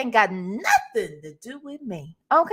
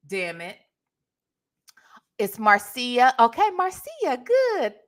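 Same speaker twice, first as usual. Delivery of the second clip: usual speaking voice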